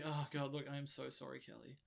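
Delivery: low, soft mock apology; hushed and low, owning up sheepishly; quietly confess, in an acted apology; apologetic. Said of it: "He's like".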